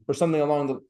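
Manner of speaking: ordinary talk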